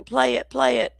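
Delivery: high, lightly put-on voice of a chatter pleading; mock chat voice. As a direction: playfully voice a viewer begging to play it. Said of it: "Butterfly says".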